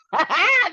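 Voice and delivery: high loud laugh; burst of laughter. Real, not acted. Laughing at own texting mistake, loud high laugh. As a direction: crack up at the memory.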